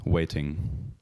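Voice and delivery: slow, soft, suspenseful announcer; hushed dramatic build-up. Acted. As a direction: draw out the tension quietly.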